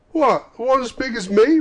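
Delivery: put-on high, loud, amazed young voice; boy's voice. A narrator's reading. Boy to Scrooge about the turkey, amazed. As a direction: call back as an astonished lad. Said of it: "returned the boy".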